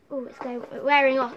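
ordinary talk, nothing marked

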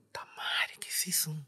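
unvoiced awed whisper; shocked hushed whisper. Sees figure on door.

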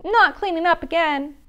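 loud high whine; whining penguin. Acted. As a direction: complain like a sulky chick. Polly complains.

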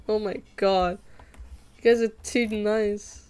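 normal talking voice

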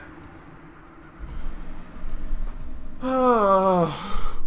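long loud groaning sigh; drawn-out loud exhale; exasperated sigh. Real, not acted. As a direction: let out a long, falling groan of defeat. Exasperated after dying in game.

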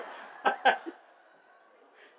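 loud breathy burst of laughter; real delighted laughter; big laugh. Real, not acted. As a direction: laugh hard at the memory. Laughing at his own story.

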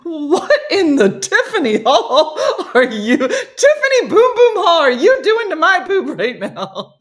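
high, loud exclamation through laughter; laughing mock outrage. Playing the squeezed wife confronting the culprit, laughing mock outrage. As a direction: demand it in hammed-up outrage, cracking up.

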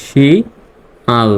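শি-আল split slowly into two beats, শি then আল; syllable by syllable. Shown as a demonstration. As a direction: drag out শি-আল one syllable at a time. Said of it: "শিয়াল উচ্চারণ হবে".

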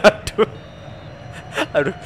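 laughing so hard it hurts; wheezing laugh, clutching stomach; laughing through pain. Real, not acted. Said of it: "Aduh, perut saya sakit".

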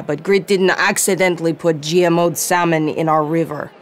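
usual speaking voice

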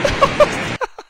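airy high giggle burst; high breathless laugh. Real, not acted. Laughing again at the reporter's clip. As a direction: crack up again.

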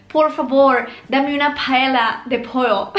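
Spanish with a put-on American accent; mock a gringo saying Spanish. Teacher imitates an American ordering paella, American accent. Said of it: "Pronuncian tal que así".

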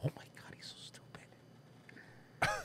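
a slow whisper of disbelief; disbelieving whisper. Real, not acted. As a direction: breathe it out, stunned by the stupidity. Aside while the clip plays.

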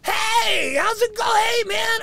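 high, loud friendly greeting. Retold or imitated. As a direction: squeak out a cheery hello. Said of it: "He talks in a high-pitched".

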